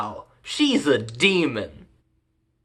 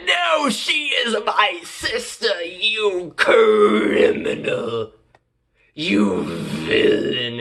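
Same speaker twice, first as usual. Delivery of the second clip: hammy melodramatic hero; 3 semitones higher, much slower than usual. Acted. Brother denounces the slayer, overblown melodrama. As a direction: denounce him in drawn-out mock outrage.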